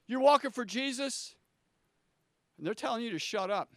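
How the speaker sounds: usual speaking voice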